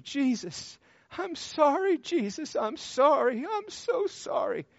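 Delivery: high, pleading apology through mock tears; imitated tearful cry. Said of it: "And she's crying".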